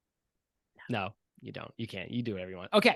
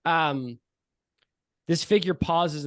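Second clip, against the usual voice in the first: usual speaking voice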